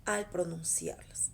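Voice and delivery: ordinary talk, nothing marked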